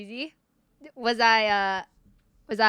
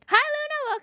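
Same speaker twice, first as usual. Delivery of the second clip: greeting pitched way up, cheery; bright greeting; slower than usual. Real, not acted. Streamer greeting a returning viewer, pitched up and cheery. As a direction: sing out a hello to a viewer.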